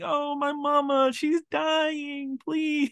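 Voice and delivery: high, pleading fake sob voice; fake crying kid. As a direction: whimper like a child putting on tears. Kid acting sad to fool nurse.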